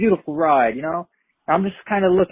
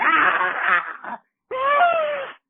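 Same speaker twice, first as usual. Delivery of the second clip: high-pitched screams of a panicked crowd; imitated screaming. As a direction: mimic terrified people screaming. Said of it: "You hear people scream".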